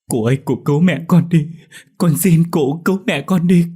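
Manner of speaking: high, loud sobbing plea; desperate tearful pleading. A narrator's reading. Dậu tugging the elder's hand, begging tearfully. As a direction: beg as if it's life or death. Boy begs elder to save mother.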